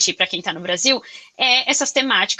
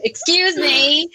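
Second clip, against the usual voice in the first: mock polite; 4 semitones higher, 3 dB louder, much slower than usual. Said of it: "Eu até falei brincando".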